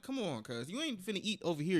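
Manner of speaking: usual speaking voice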